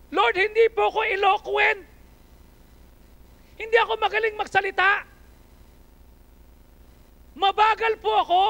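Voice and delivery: high, loud pleading excuse; Moses pleading. Retold or imitated. Moses pleading with the Lord, loud and high. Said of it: "Ano sabi niya?".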